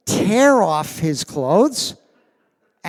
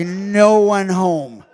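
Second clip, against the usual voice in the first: blank stare; 2 semitones higher, 6 dB louder, slower than usual. Retold or imitated. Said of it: "It was like".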